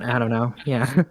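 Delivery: usual speaking voice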